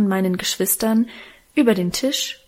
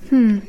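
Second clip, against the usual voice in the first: a closed-mouth hm, pushed out a touch loud; grumbled hum; 6 dB louder than usual. Acted. Alwine making her siblings wait, grumbling hm. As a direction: hum it off like you can't be bothered. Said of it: "brummte unsere Alwine".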